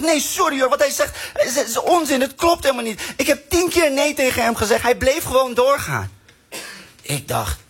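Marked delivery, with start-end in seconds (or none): 0.0-6.1 put-on young woman. Comic voices the young woman.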